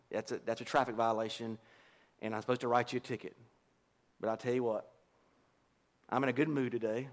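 lightly voiced easygoing police officer; mildly voiced officer at the car window, amused; a hint of a laid-back cop. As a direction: play the cop letting you off easy, half laughing. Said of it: "Kevin walks up to your car, says".

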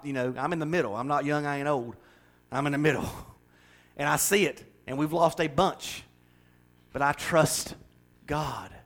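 ordinary talk

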